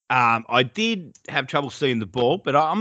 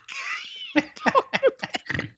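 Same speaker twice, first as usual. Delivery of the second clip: high squealing laugh; 3 dB quieter than usual. Real, not acted. Cracking up at own story.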